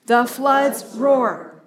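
drawn out and heavy, stress on "floods"; slow unison recitation. Shown as a demonstration. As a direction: let the phrase rumble slowly for the group to echo. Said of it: "Say it with me".

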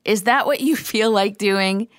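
laughing mid-question on you; a half-laughed repeat of the question. Real, not acted. Teacher laughing as she asks listeners again. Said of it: "No, really".

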